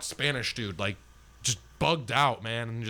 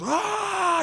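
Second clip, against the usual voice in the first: short mock demon shriek, far higher and louder; imitated demonic scream; 4 dB louder than usual. Retelling the friend punching the wall, mock demonic scream. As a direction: shriek it like a possessed man, briefly. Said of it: "this really weird demonic scream".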